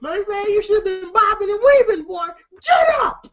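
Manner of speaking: high-pitched imitated nagging, rising to a shout; mock scolding. Ezal scolding Debo on the ground, mock high-pitched scolding.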